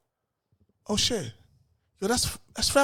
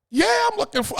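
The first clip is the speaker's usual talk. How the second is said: much higher eager yes; high keen agreement; 14 semitones higher than usual. Retold or imitated. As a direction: jump at the offer. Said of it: "And I was like".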